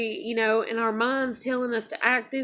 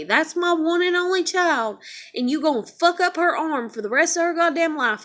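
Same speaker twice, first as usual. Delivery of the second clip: higher, louder threat in rage; real furious yell; 6 semitones higher, 4 dB louder than usual. Real, not acted. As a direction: threaten the bully in raw anger. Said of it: "that's how mad it makes me".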